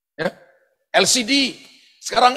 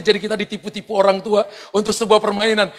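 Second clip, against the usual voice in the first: ordinary delivery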